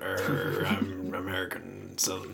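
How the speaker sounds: low, slow Southern American drawl; Southern American accent. Pretend. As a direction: growl it like an old Southern American man. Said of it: "you would have that sort of accent".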